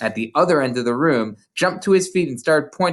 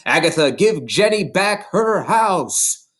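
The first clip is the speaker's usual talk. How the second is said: slow, louder ghostly command; 6 semitones higher, 4 dB louder, slower than usual. A narrator's reading. Ghost ordering his killer, slow and loud. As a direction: command it from beyond.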